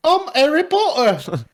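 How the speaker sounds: put-on high, loud, slow squeaky boy; mock Harry Potter. Acting out a bad audiobook Harry, high and loud. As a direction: squeak it like a tiny wizard. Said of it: "you're gonna do Harry".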